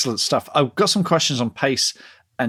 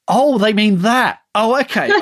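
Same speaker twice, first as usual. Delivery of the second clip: dawning realization; 9 semitones higher, 6 dB louder than usual. Retold or imitated. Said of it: "And I was like".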